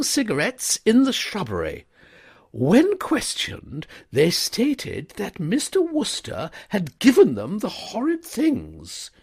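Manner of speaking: high, loud, indignant lady; scandalised, pressing the complaint; stern schoolmistress reporting a scandal; outraged headmistress. A narrator's reading.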